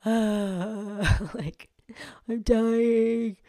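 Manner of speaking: loud, high, hammed-up I'm dying; mock dying groan. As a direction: whine it like you're finished. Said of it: "I'm over here like".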